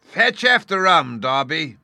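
eerie wailing command; ghostly wailing call. A narrator's reading. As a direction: call it out like a phantom captain. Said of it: "rising a little higher and with an oath".

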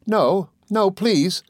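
high, pleading wife's voice; playful cry. A narrator's reading. Dora begging her husband not to be serious, crying out playfully. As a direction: plead prettily, pitched well up. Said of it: "cried Dora w-, with a kiss".

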